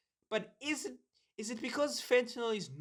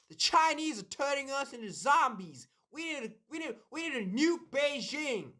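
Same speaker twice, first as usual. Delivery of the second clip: high, loud, hammed-up accent of a TV war hawk; Warhawk's terrible accent; 7 semitones higher, 4 dB louder than usual. Imagining Warhawks alarmed on the news, in a terrible put-on accent. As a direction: rant in a badly faked warhawk accent. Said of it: "That was such a terrible accent".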